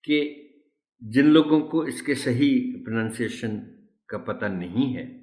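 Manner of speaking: ordinary talk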